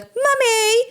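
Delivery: put-on very high little British child voice; British kid. Imagined British kid calling for mom, high child voice. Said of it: "my British kid can be like".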